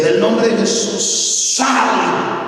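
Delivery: high, ringing command to the demon; preacher's exorcism shout, high and slow; commanding exorcism cry. Retold or imitated. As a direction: order the spirit out, rising hard. Pastor casting out demon.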